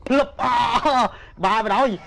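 high, loud gamer shout; yelling at the screen mid-fight; excited yell. Real, not acted. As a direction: call out in a rush. Tense moment in game.

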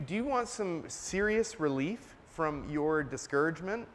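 ordinary delivery

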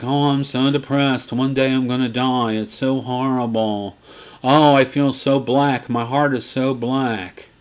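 low, soft mock-despairing moan; mopey goth. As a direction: act out a melodramatic goth, sighing. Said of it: "some goth is gonna be like".

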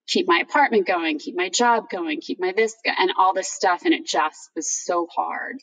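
ordinary delivery

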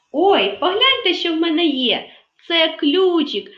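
high, loud show of delight; oh-look excitement, put on for kids; playful surprise. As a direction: discover a treasure for the children. Teacher stages a surprise discovery.

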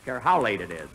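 ordinary talk, nothing marked

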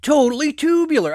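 high, loud surfer-dude yell; Mikey voice. Acted. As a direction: belt it like a hyped cartoon turtle. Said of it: "Can you express Mikey voice".

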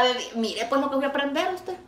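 normal talking voice

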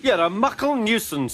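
high-pitched cross engine; angry. A narrator's reading. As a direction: scold in a high, indignant voice. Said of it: "was angry".

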